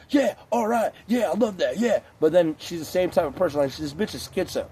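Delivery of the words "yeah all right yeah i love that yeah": mock fake agreement. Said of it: "she's always acting like".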